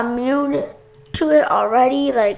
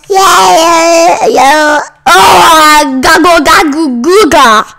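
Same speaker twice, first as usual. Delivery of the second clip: loud, higher silly yelling of nonsense; silly shouting; 3 semitones higher, 6 dB louder, slower than usual. Pretend. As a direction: holler the gibberish like a goofy kid.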